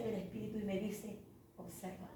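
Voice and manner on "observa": very soft, inward voice of the Spirit; hushed command. Retold or imitated. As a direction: drop to a near-whisper, as a quiet order. Spirit's command heard in prayer. (orden susurrada, muy bajito)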